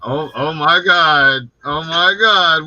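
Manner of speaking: excited. Real, not acted. Excited greeting of arriving guest.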